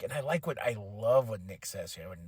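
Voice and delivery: ordinary talk, nothing marked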